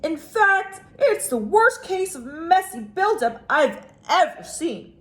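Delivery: a dad settling a family quarrel; firm father. Acted. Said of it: "he continued".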